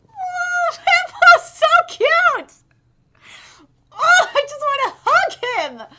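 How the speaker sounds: very high, loud, slow squeal; high loud cooing squeal; cute-aggression squeal. Real, not acted. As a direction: squeal like you want to hug him.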